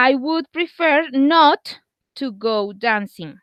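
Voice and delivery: slow, careful English example; slow model sentence. Shown as a demonstration. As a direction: say it slowly for the class. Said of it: "teacher models example slowly".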